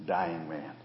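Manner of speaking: ordinary talk, nothing marked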